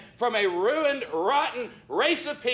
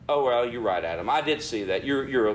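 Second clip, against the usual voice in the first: lower, indulgent, hammed-up God voice; mock lenient Lord; 9 semitones lower than usual. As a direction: play the Lord going easy on Adam, lower. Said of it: "God didn't look at Adam and say".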